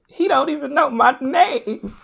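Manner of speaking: high, drawn-out wail breaking into laughter; mock complaining wife. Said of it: "she's like".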